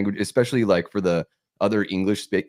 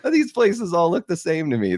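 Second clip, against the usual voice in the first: much higher-pitched acted-out remark; mock realization; 11 semitones higher than usual. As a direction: play up an exaggerated reaction. Acting out his disillusioned reaction.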